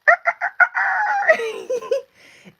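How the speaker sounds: very high, loud cock-a-doodle-doo; rooster crow imitation. Reminding viewers of the farm rooster, loud high crowing. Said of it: "the cock-a-doodle-doos used to crow".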